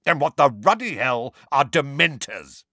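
high, exasperated uncle; angry demand. A narrator's reading. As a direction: demand an answer angrily. Uncle angrily demands an explanation.

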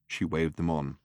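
ordinary delivery, nothing marked

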